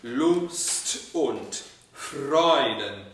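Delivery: Lust with a long held ST, very slow; every bit of the word. Shown as a demonstration. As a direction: dwell on each sound of Lust und Freuden. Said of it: "dip your toes into every bit of that word".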